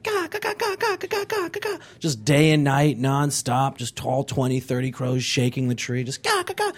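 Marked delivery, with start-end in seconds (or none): 0.0-1.8 high, soft cawing like a crow; mock crow calls; crow caw impression. Imitating crows cawing. 6.2-6.7 high, soft cawing like a crow; mock crow calls; crow caw impression. Imitating crows again.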